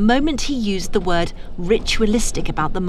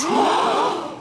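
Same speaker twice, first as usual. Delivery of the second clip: shocked gasp; 10 semitones higher than usual. Acted. Shocked accusation scene.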